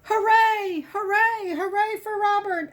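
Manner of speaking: a storybook crowd cheering. A narrator's reading. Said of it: "everyone yelled".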